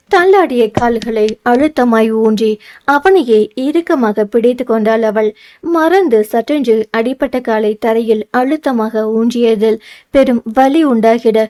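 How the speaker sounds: plain reading voice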